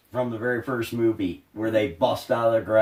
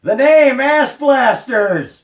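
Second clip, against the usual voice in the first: loud, high, drawn-out exclamation; high-pitched shout of real exasperation; exasperated outburst; 11 semitones higher, 9 dB louder, slower than usual. Real, not acted. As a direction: cry it out in exasperation, stretching the words. Exasperated answer about the hated name.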